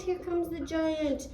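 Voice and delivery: ordinary delivery, nothing marked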